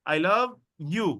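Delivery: I love together, then a break before you; pause, then release you on its own; last word separated. Shown as a demonstration. Teacher modelling English phrasing, you set apart. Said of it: "last ওয়ার্ডটা আলাদা হবে".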